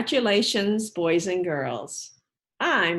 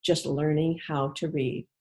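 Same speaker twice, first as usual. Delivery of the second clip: put-on reading to children; 4 dB louder than usual. Teacher reading the bucket book to her class, storybook voice. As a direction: read it to a class of little kids.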